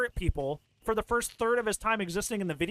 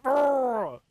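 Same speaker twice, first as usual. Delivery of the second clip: loud mock troll roar, pitched up; troll groan; 4 semitones higher, 6 dB louder than usual. Demonstrating the stunned killer's noise, troll groan. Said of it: "like a troll".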